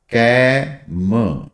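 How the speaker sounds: कैम drawn out very slowly, vowel held long; slow stretched demo. Shown as a demonstration. Teacher stretching कैम for students, slowly. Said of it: "कैम को अगर ध्यान से समझोगे".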